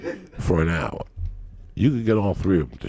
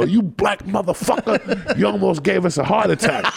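startled laughing complaint; 9 semitones higher than usual. Retold or imitated. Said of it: "would duck and go".

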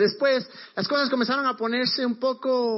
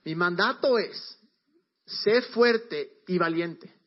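slow and grave, every word of "mi mandato es, sé fuerte y valiente" weighed; God getting serious; much slower than usual. Shown as a demonstration. God gives Joshua his command, slow and solemn. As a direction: lay down the command slowly like a serious order. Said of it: "Dios ya se pone en serio".

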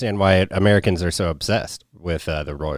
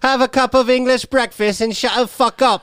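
much higher falsetto English lady; prim tea-lady telling her to shut up; mock English accent. Mocking royals to the host, English accent.

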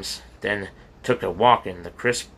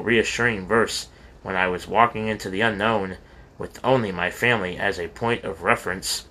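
ordinary delivery, nothing marked

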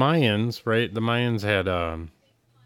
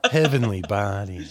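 mock ad voice. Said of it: "You do it better".

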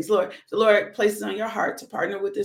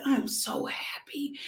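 soft whisper; 5 semitones higher, 8 dB quieter, slower than usual. Retold or imitated. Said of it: "I said".

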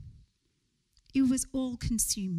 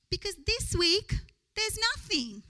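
whiny rich friend; 8 semitones higher than usual. Retold or imitated. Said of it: "So why are you so sad?".

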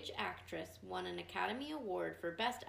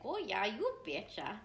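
put-on Midwest accent; 2 semitones higher, 7 dB louder than usual. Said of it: "so they all talk like".

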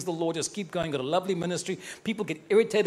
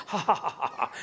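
a showy ha ha ha laugh at the rich; mocking laugh. Preacher mocking the world's richest men, laughing. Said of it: "I laugh at them".

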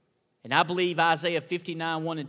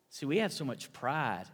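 normal talking voice

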